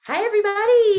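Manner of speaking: high, bright host welcome; loud cheery greeting. Real, not acted. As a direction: greet the crowd with a big lift. Greeting the live audience.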